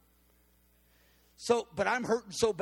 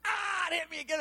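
sudden pain; 11 semitones higher than usual. Retold or imitated.

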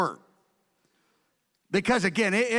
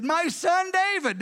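higher and slow, said with affection; fond address; 9 semitones higher than usual. Retold or imitated. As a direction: say it like a father moved to tenderness. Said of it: "he said".

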